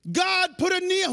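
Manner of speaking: high, loud plea; loud prayer. Acted. Believer praying to God for a neon sign, loud plea. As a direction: cry it up to heaven. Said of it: "whoever prayed this".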